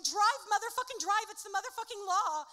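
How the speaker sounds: frantic high, loud scream. Acted. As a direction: yell it like the cops are right behind. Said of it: "Ike jumps in the car screaming".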